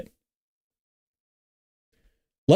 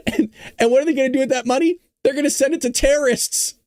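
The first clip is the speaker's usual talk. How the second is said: laughing; 10 semitones higher than usual. Real, not acted. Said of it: "I'm laughing because it's so preposterous".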